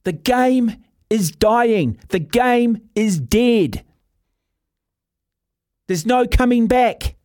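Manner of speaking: slow, drawn-out pronouncement; emphatic verdict. Real, not acted. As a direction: hammer out each phrase like a final verdict. Grim verdict on the dying game.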